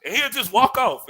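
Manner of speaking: ordinary talk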